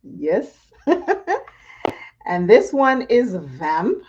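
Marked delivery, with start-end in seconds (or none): 0.8-1.4 short high laugh; high giggle. Real, not acted. Laughing at viewers mid-makeup. Laughing after applying eyeshadow.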